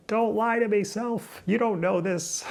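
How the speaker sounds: high, whiny back-talk to herself; high mocking inner voice. Scolding her own hopeful self, high and whiny. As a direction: argue with yourself in a squeaky voice.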